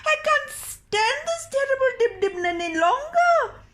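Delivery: put-on a high old crone wailing in misery.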